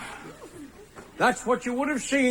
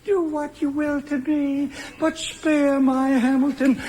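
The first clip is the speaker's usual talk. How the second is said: Lucy. Acted. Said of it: "when Lucy cries".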